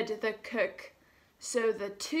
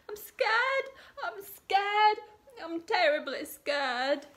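high, trembling frightened cook voice; scared cook; 9 semitones higher than usual. Acted. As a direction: squeak it out like a terrified little cook. Scared cook repeats his fear.

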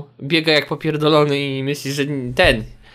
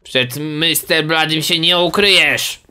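slow, drawn-out mock menace; taunting seeker; slower than usual. Seeker to hiding Max in the game, playful menace. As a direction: stretch it out like a hunter stalking prey.

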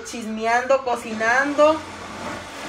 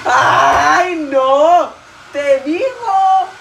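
loud, laughing outburst; excited shout; 3 semitones higher, 5 dB louder, much slower than usual. Real, not acted. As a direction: yell it out mid-laugh. Laughing outburst at her mother.

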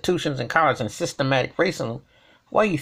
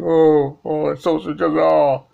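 hammed-up whimpering, louder on each oh; mock crying; 3 semitones higher, 5 dB louder than usual. Mocking the ESPN commentator's tears with a fake wail. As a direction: mimic a weepy anchor's fake sorrow. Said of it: "I'm supposed to buy this turkey up here crying".

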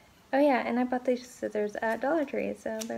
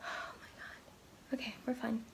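hyperventilating; 9 dB quieter than usual. Real, not acted. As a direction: whisper through panicked breaths. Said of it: "having an anxiety attack right now".

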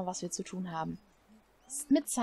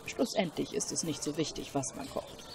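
put-on calm, lower old cook; lower wise cook voice; 5 semitones lower than usual. Streamer voicing the cook praising the ingredients, lower and calm. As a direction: speak like a wise old chef.

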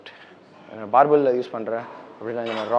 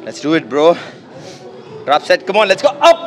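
loud, higher pre-set hype; hyped up; 7 semitones higher, 7 dB louder, slower than usual. Real, not acted. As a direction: psych yourself up loudly. Psyching up for drop set.

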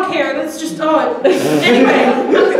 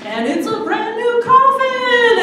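game show host; 10 semitones higher, 3 dB louder, slower than usual. Pretend. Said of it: "I'm expecting them to be like".

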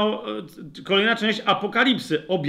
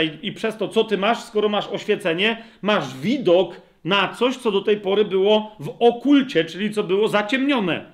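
ordinary delivery

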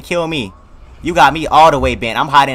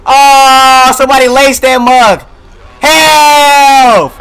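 high, very loud wail; panicked yelling; 9 semitones higher, 10 dB louder, much slower than usual. Real, not acted. Player realising the coffee was laced, panicked yelling. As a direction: holler long and high for help. Character drugged, player yells.

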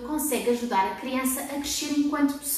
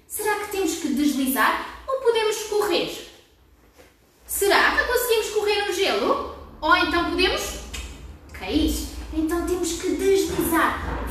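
kindergarten teacher voice; 4 semitones higher than usual. Acted. Questions to children.

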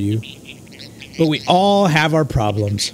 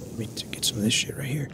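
breathless hushed whisper, fast; quick secret whisper; sneaky whisper; 4 semitones lower, 13 dB quieter, faster than usual. Acted. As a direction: whisper it like you're stealing food. Sneakily grabbing food in game.

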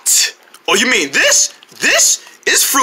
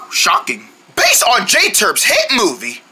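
ordinary delivery

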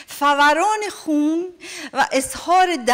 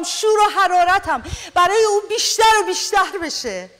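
rising, impassioned sermon voice, higher and fuller; fervent preaching; 5 semitones higher than usual. Acted. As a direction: preach it with growing fire.

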